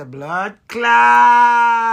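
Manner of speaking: loud shouted Jamaican curse, pitch up. Retold or imitated. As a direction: blurt it out at full volume. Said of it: "me have to say".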